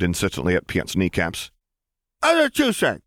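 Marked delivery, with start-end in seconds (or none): 2.2-3.0 put-on very high little boy; high child voice; toddler voice. A narrator's reading. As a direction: pipe up like a small child. Ben speaks.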